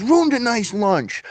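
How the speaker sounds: high, drawn-out movie quote; imitated movie gangster. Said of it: "And he goes".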